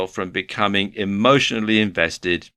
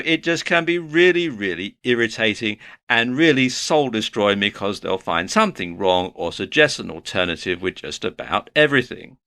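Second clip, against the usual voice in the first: usual speaking voice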